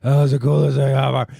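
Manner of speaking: loud excited blurt, imitating his son; thrilled kid. Dad retelling his son's reaction after the ride, loudly thrilled. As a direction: gush loudly like a lit-up kid. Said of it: "his eyes were lit up".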